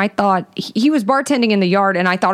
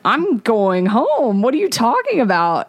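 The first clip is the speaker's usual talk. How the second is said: slow, stretched-out, higher-pitched disbelief; incredulous; 4 semitones higher than usual. Retold or imitated. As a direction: act out baffled refusal, drawing out the words. Retold incredulous refusal to the date.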